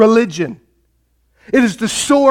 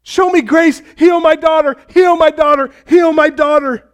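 begging cry; 6 semitones higher than usual. Retold or imitated. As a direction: plead with Jesus to heal her daughter. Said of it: "She's begging Jesus to heal".

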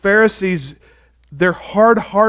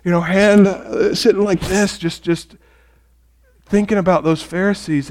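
ordinary delivery

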